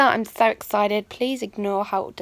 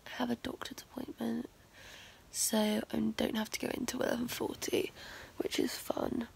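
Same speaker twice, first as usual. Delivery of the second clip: whisper; 15 dB quieter than usual. Real, not acted. Early-morning vlog, whispered.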